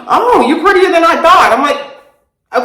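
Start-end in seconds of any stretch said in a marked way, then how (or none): 0.1-1.5 higher-pitched imitation of an impressed dad; lightly mock a pleasantly surprised dad; surprised compliment. Dad's surprised compliment, retold.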